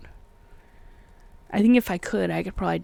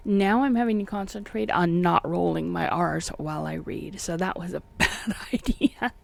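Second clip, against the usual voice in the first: ordinary delivery, nothing marked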